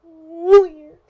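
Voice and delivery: high, loud squeal of the word; a playful shriek on Weird; silly squeal. Pretend. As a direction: squeal it out like a goofy cheer.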